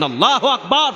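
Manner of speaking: high, ringing takbir shout; imitated rallying cry. Preacher voices Imam Mahdi's proclamation, high shouted takbir. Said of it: "চিৎকার দিয়ে বলেন".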